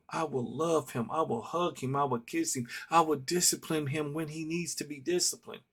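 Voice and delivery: ordinary delivery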